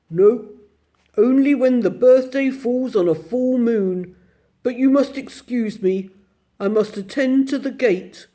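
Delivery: a lightly voiced gruff bear gatekeeper; a hint of a deep gruff doorman. Bear at the gate welcoming the children, lightly gruff. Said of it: "deep, gruff voice".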